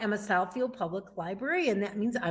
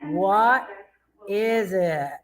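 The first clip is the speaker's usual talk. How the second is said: ordinary talk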